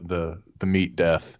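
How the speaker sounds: ordinary talk, nothing marked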